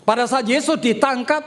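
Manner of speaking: ordinary delivery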